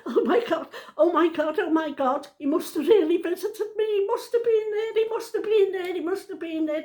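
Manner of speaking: high, loud, overwhelmed outpouring; re-enacted sobbing. Nan realising her dead husband visited her, re-enacted sobbing. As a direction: wail it in disbelief, reliving the moment. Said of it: "uncontrollable sobbing".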